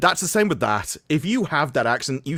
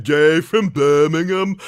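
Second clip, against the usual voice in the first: slow, louder mock Brummie accent; 5 dB louder, slower than usual. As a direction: put on a Brummie accent for the name. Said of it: "Brummie accent".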